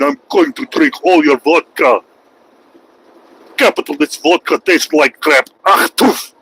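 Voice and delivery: hammed-up Russian villain voice; mock Russian accent. Streamer imitating a Russian wrestler from childhood, mock Russian accent. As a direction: sneer like a pantomime Russian wrestler. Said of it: "he used to talk like this".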